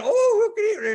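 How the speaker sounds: very high, delighted oh; delighted surprise. Retold or imitated. Operator delighted to hear he is Canadian, very high. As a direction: squeal with delight.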